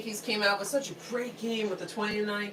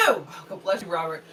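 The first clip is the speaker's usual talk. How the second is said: ordinary delivery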